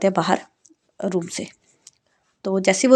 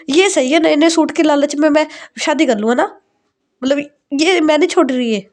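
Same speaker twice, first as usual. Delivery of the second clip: higher, pleading complaint to mom; whiny protest; 6 semitones higher than usual. Acted. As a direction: whine like a spoiled daughter. Daughter mocks the bribe of suits.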